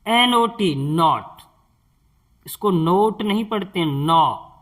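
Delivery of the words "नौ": ordinary delivery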